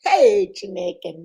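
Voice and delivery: usual speaking voice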